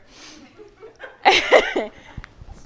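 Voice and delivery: high laugh at own joke; real laugh. Real, not acted. Speaker amused by own retort, real laugh.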